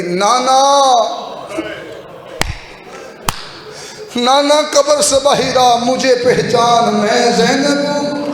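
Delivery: a grieving daughter calling at the grave, lightly tearful; crying plea. Acted. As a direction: plead through tears to the grandfather. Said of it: "बीबी रो के कहती".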